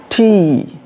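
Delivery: T high and falling, with a puff of air; long, emphatic T. Shown as a demonstration. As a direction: pop and stretch the letter T. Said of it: "you need more duration emphasis".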